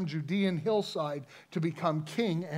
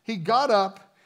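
ordinary talk, nothing marked

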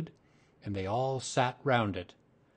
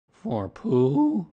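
higher, louder incredulous question; taken aback; 5 semitones higher, 8 dB louder than usual. A narrator's reading. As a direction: echo it in affronted disbelief. Eeyore learns gift isn't his.

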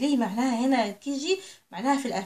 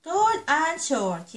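drawn out slowly and a bit louder; slow clear read; 4 semitones higher, 4 dB louder, much slower than usual. Shown as a demonstration. Teacher reading new words slowly to children. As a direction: spell it out slowly so they catch it.

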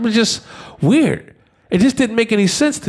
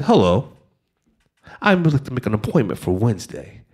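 lower, softer, politely calm; calm polite request; 11 semitones lower, 4 dB quieter, faster than usual. Retold or imitated. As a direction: ask as if booking a haircut. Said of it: "since you said it so calmly".

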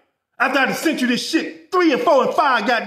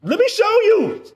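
laughing taunt; 6 semitones higher than usual. Real, not acted. Laughing at client's inaction.

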